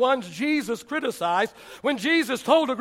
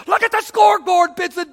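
loud, higher imitation of an angry coach; coach yelling; 6 semitones higher, 6 dB louder than usual. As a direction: bark it like a coach in your face. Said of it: "got in my face and says".

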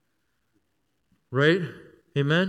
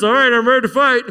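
higher, louder, mock-eager fighter; choir member mock battle cry; 6 semitones higher, 7 dB louder than usual. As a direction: play an unlikely soldier charging out. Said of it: "coming out like this".

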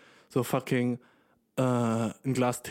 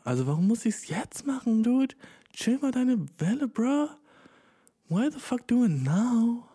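heated rant; 10 semitones higher than usual. Acted. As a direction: rant at the critics, voice pitched up. Mock outrage rant.

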